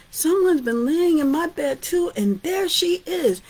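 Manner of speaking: high, loud, excited little bear cry; Baby Bear. Acted. Baby Bear discovering Goldilocks in his bed, high and loud. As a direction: shout it like a startled cub.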